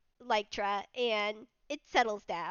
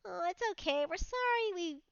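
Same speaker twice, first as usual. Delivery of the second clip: higher, tender cooing; cooing to beetle; 6 semitones higher than usual. Acted. Mad Lad cooing tenderly at the nuzzling beetle. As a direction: coo at it like a pet.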